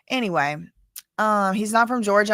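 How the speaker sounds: normal talking voice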